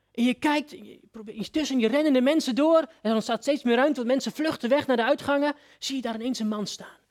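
ordinary talk, nothing marked

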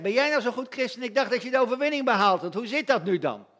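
sneering devil's voice; accusing voice of the devil. Retold or imitated. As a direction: taunt them like the accuser. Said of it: "Die klaagt je aan".

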